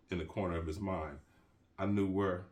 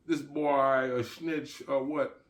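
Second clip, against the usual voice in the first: put-on Jamaican accent; 6 semitones higher, 4 dB louder, slower than usual. Jamaican asks partner about stranger.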